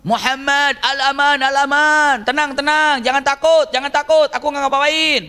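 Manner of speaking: high, loud cry for safe passage; imitated frantic shouting. Suraqah calls to Muhammad for safety, shouting high. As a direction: call out high and loud, as if to someone ahead. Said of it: "Maka dia teriak-teriak".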